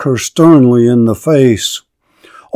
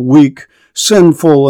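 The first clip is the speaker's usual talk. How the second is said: ordinary delivery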